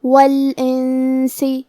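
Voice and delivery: "والانسی" very slowly, noon hidden in a held nasal hum; ghunnah recitation. Shown as a demonstration. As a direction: recite "والانسی", humming the hidden ن through the nose. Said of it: "نون ساکن کو چھپاتے ہوئے اور غنہ کے ساتھ".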